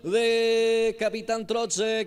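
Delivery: high, slowly stretched goal cry; goal shout. Real, not acted. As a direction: hold the goal call long.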